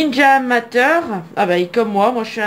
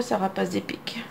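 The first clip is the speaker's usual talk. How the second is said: soft unvoiced whisper; 4 semitones lower, 11 dB quieter than usual. Real, not acted. Collector murmuring over a bird card, whispered. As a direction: breathe it as a quick aside. Aside to the viewer about a card.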